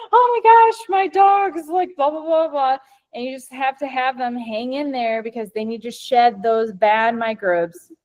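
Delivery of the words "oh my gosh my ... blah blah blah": panicked pet owner. Retold or imitated. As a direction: squeal it like a worried dog owner. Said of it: "they're gonna be like".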